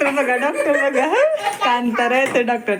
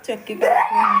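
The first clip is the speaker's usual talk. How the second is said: cartoon call-out. Mother mimics cartoon shout.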